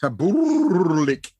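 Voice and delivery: TABULIK copied slowly with a long trilled middle; imitated rolled L. Bard trying the newcomer's name, Tabulik with an exaggerated rolled L. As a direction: mimic Tabulik, stretching the trill before "ik". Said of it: "rolling his L's".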